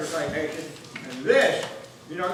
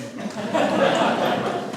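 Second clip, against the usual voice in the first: breathy laugh; 7 semitones higher, 6 dB louder than usual. Real, not acted. Veteran laughs at his own joke.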